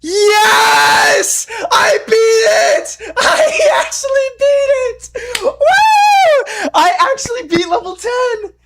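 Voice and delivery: very high, loud screaming and crying; victory scream. Real, not acted. Gamer finally beats level ten, crying victory scream. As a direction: scream with joy, voice breaking.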